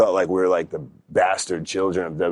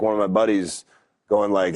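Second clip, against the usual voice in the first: normal talking voice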